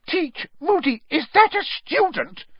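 horrified high-pitched shriek; McGonagall. A narrator's reading. McGonagall realizes the ferret is a student, shrieked. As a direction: shriek it in disbelief. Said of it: "shrieked Professor McGonagall".

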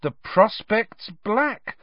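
drawn-out high dread; horror-struck recitation. A narrator's reading. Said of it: "Harry recited, staring, horror-struck".

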